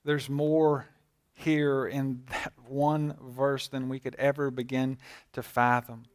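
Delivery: ordinary talk, nothing marked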